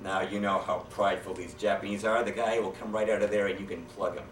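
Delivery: normal talking voice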